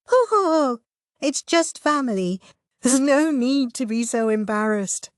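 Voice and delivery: high, amused teasing; chortling. A narrator's reading. Sister teasing her embarrassed brother, chortling. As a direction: chuckle through it, teasing him. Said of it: "Shu chortled".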